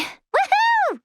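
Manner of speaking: very high, sustained whoop; high celebratory holler; excited cheer. Acted. Character cheers.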